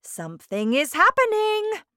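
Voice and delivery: loud, climbing, thrilled voice; excited cry. A narrator's reading. Wonka seeing the screen change, crying out excitedly. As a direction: let excitement lift your pitch high.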